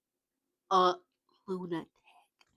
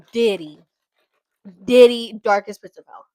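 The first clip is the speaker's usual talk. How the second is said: loud verdict; 13 dB louder than usual. Real, not acted. Verdict on a celebrity.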